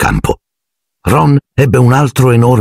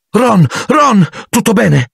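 much higher, anxious call; worried cry; slower than usual. Acted. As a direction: cry out for Ron. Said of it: "gridò Hermione". (grido ansioso e acuto)

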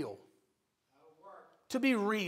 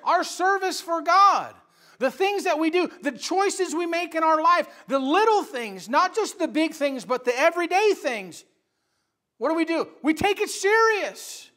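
high, louder preacher's exhortation; forceful preaching; 6 semitones higher, 7 dB louder than usual. Real, not acted. Preacher urging people to take life seriously, forceful preaching. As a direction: press it on the congregation with force.

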